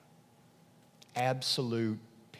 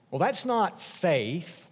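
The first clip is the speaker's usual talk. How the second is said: normal talking voice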